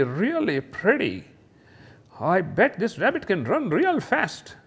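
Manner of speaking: high, cooing admiration; gushing delight. Acted. Teacher gushing over a rabbit picture, high and cooing. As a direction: coo over the cute bunny.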